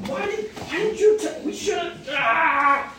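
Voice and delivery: mock the ten fuming that they missed out; hammed-up jealous whine, then a yell; whiny jealous disciples. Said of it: "They were like".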